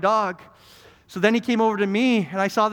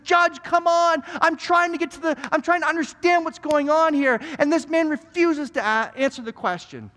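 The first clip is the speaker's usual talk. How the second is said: upset lawyer; 9 semitones higher than usual. Acted. Lawyer appealing to the judge about a stubborn witness, upset. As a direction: plead indignantly, pitch way up. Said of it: "he's so upset".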